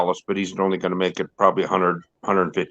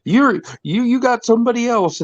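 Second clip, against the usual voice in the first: high, sulky talking-dog voice; whiny jealous dog, pitched way up; cartoon dog; 14 semitones higher than usual. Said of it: "the guy's doing the voice for the dog".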